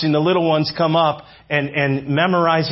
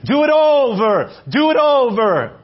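high, loud coach's command; Bella Caroli; 10 semitones higher, 4 dB louder than usual. Retold or imitated. Gymnastics coach drilling young gymnasts, shouted command. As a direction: shout the order like a demanding coach. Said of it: "he would make this statement".

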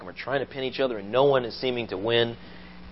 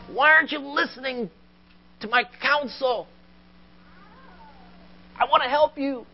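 high, insistent voice of God appealing; imitated divine appeal, pitched high; God pleading; 14 semitones higher than usual. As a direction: plead as God to someone who won't listen. Voices God pleading with non-listener.

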